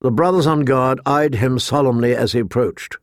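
ordinary delivery

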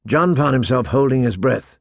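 usual speaking voice

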